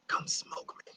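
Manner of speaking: beckoning unvoiced whisper; very soft, luring whisper. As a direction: whisper it like a temptation from the background. Weed calls from the background.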